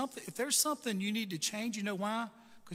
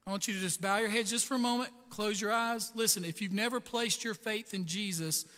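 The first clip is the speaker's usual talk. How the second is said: louder, earnest invitation; altar call; 2 semitones higher, 5 dB louder than usual. Acted. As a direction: invite them in gently but firmly.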